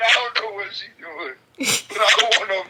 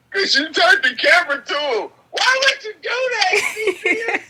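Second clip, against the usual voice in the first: high, loud, hammed-up complaint; mock outraged scolding; 10 semitones higher, 4 dB louder than usual. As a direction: wail the accusation, breaking into laughter. Laughing mock scolding over camera.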